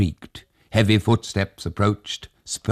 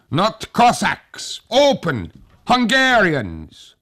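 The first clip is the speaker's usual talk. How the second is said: much higher, louder, slow shouted answer; soldier's shout; 4 dB louder, slower than usual. Acted. Hussar outside answering old Tag, slow loud shout. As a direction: bellow it through the glass.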